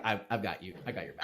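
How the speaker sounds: low and soft, steady; quiet reassurance. Acted. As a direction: murmur it like a promise between friends. Orton reassures Darren.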